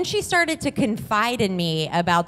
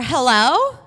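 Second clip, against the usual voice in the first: questioning, higher-pitched hello; ex calling hello; 5 semitones higher, 4 dB louder than usual. Retold or imitated. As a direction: call out a tentative hello, imitating the ex. Ex's unexpected voice is heard.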